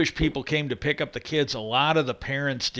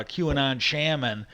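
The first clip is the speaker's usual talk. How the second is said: ordinary talk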